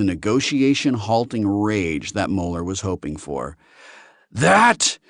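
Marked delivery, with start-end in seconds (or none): 4.3-5.0 high, loud bellow of outrage; enraged alien bellowing at the room. Acted. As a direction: roar it out, stretching the words as patience snaps. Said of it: "he bellowed".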